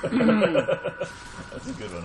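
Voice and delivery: laughing it out slowly. Real, not acted. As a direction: say it through laughter. Laughing at the joke.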